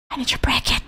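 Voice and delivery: high urgent whisper. Real, not acted. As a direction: whisper it desperately. Whispered urge.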